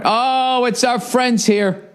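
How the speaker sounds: high, drawn-out singsong welcome; put-on high cooing announcement; mock delight. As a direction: greet old friends with hammed-up delight. Mock greeting of the ad sponsor.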